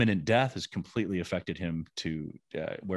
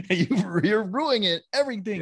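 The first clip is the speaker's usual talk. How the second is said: put-on high, whining kid complaining; whiny child voice; 12 semitones higher, 5 dB louder than usual. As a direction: whine it like a sulky teenager. Son's complaint voiced in story.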